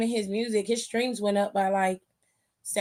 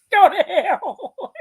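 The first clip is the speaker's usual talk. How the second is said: high, drawn-out words through laughter; loud, laughing dismissal; laughing retort; 9 semitones higher, 6 dB louder, much slower than usual. Real, not acted. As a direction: crack up while saying it, loud and stretched. Cracking up at co-host's joke.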